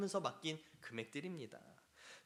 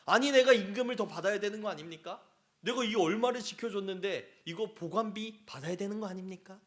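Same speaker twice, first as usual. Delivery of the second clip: loud, higher, mock-outraged claim for wages; indignant demand; 4 semitones higher, 9 dB louder than usual. The servant demanding pay from his master, loud mock outrage. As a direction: demand your storage fee like a wronged worker. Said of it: "이 종은 이렇게 얘기할 수 있는 거예요".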